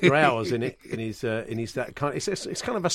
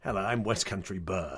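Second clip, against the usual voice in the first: mock West Country burr, lower and softer; 8 semitones lower, 10 dB quieter than usual. Co-host mocking the trainer's West Country accent, hammed-up. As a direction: play a rustic West Country yokel, lightly hammed. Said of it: "he's sort of a slight West Country burr".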